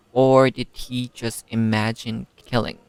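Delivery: ordinary talk, nothing marked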